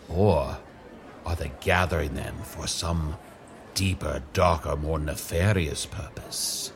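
ominous hush. Acted. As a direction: drop the voice to a dark hush. Ominous speculation about villains.

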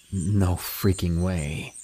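slow, stunned disbelief; slowly. Retold or imitated. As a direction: drag it out, shaking his head.

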